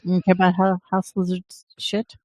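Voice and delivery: normal talking voice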